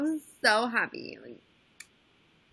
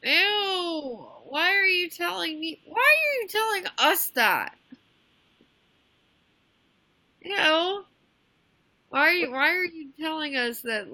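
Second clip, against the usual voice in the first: high, loud disgusted protest; squealing in disgust; grossed-out ew; 6 semitones higher, 4 dB louder than usual. Real, not acted. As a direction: recoil from the overshare. Friend overshares about her mom.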